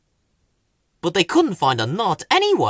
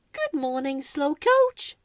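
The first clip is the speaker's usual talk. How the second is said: higher little plant voice; a touch of a small high voice greeting; lightly voiced cheerful greeting; lightly voiced Weed; 12 semitones higher than usual. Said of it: "said Weed".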